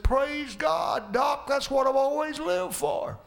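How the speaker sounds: dying Billy rejoicing to the doctor; joyful praise. Retold or imitated. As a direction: rejoice at the news. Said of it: "Billy said".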